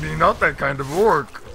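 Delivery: loud orc peon voice; grumbling peon refusing work. Pretend. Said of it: "Like my orc voice?".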